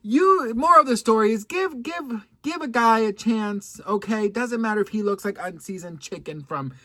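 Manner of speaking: high, loud sermon-like advice; loud preachy advice. Real, not acted. Giving viewers the moral, loud and high.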